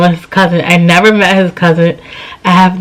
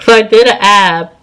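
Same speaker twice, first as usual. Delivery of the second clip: ordinary delivery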